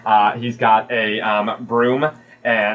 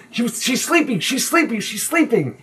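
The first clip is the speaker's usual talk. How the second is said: put-on high child voice, phrase repeated urgently; insistent kid; 12 semitones higher than usual. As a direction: cover up hastily like a little child. Sprat covers up death.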